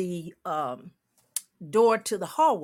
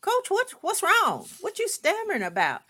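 much higher, puzzled; higher quizzical voice; 12 semitones higher than usual. Retold or imitated. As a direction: ask way up high, baffled. Her own retold reply.

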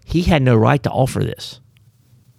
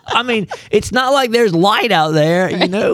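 laughing; 10 semitones higher than usual. Real, not acted. Laughing while joking.

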